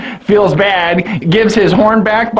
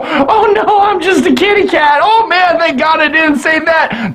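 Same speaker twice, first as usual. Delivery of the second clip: put-on high, laughing girly voice; giggly [name]; 8 semitones higher than usual. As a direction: play a coy kitty cat.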